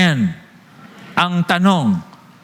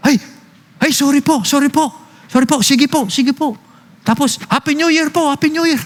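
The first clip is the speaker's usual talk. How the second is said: put-on sweet apologetic girls; 7 semitones higher than usual. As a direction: mimic the teenagers apologizing in a high voice. Neighbors apologizing sweetly, imitated. (boses ng mga dalagang humihingi ng paumanhin)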